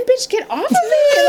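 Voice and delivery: high mock squeal, laughing; inner outburst. Telling friends what she thought on the call, high mock squeal. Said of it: "in my head, I'm all".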